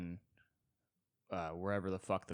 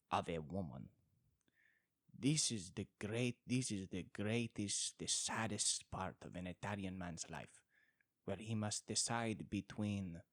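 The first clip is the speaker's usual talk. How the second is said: put-on comic Italian accent fading to a whisper. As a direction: play a tragic Italian man in a thick Italian accent. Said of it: "what do Italians sound like".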